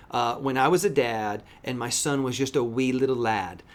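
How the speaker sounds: normal talking voice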